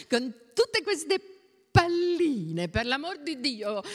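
high exclamation; alarmed cry. A narrator's reading. Anna Carla turns sharply as the child handles pearls, alarmed cry. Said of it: "gridò Anna Carla".

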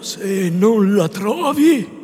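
slow solemn warning tone; grave and expectant. A narrator's reading. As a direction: let each word hang as a stern question. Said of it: "con un'aria di serietà ed aspettativa".

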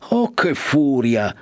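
a slow, drawn-out mock exclamation; exclaiming. Agnese protests her daughter's rush to the convent, mildly exclaiming. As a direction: Agnese cries out at Lucia's haste, a touch dismayed. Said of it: "sclamò Agnese".

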